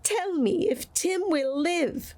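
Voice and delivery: put-on high, slow pleading old man; anxious plea. A narrator's reading. Scrooge pleading with the spirit, high and slow. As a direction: beg for the answer. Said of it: "with an interest he had never felt before".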